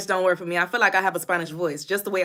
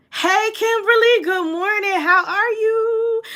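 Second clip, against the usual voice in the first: high, loud stretched hello, drawn slow on how are you; sing-song greeting; 11 semitones higher, 5 dB louder, slower than usual. Real, not acted. Streamer greeting viewer Kimberly with a high sing-song hello. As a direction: call it up like a friend walking in.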